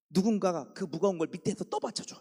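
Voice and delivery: ordinary talk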